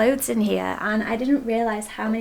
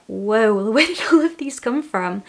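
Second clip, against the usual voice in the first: surprised; 5 dB louder than usual. Retold or imitated. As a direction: act out the shock with a laugh. Retelling shock at her clothes.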